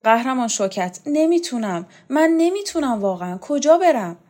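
high-pitched helpless plea; pleading. A narrator's reading. Borzu begs Showkat, saying he cannot go on, pleading. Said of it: "برزو به شوکت التماس کرد".

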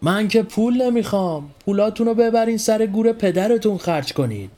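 high ringing voice from up a tree; acted high, ringing refusal; ringing, trembling voice. A narrator's reading. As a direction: call down to the crowd in a high voice. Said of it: "صدایش زنگدار بود اما مثل این که میلرزید".

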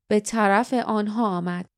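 plain reading voice

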